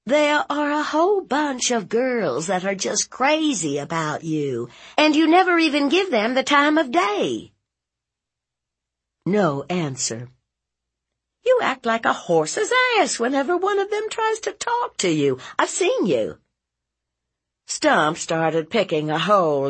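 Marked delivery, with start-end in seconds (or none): 0.0-7.5 high, mounting complaint; exasperated aunt. Acted. Aunt chiding nephew for ignoring girls, exasperated. 11.5-16.4 very high, rapid scolding; exasperated aunt. Acted. Aunt accusing nephew of snubbing girls, exasperated.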